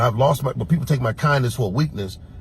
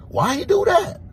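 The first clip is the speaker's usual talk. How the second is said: much higher, squeaky indignant voice; squeaky outraged why; indignant squeal. Real, not acted. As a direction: ask it in a high squeak of disbelief. Indignant repeat of question.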